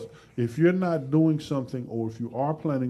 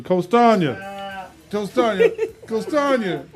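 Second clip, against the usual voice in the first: higher, louder call to the coach; 8 semitones higher, 4 dB louder, slower than usual. Real, not acted. Host calling out to his coach Tanya, loud call. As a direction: call her name across the room.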